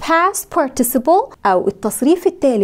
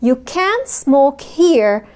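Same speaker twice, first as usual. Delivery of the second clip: very slow and higher, falling on here; slow model sentence; 3 semitones higher, much slower than usual. Shown as a demonstration. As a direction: drill it slowly for the class. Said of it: "سامعين؟". (نطق بطيء جدًا وعالي)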